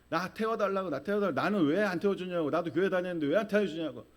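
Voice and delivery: high-pitched, insistent mock protest; imitated arguing. Churchgoers demand to board, mock arguing. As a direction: pile up the demands like a clamouring crowd. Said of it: "막 따져요".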